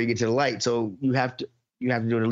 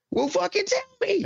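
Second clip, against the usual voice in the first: exasperated mockery. Mocking rant at driver.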